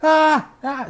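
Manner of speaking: high loud hammed-up pained cries; mock screaming. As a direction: yelp high like a dying man, hammed up.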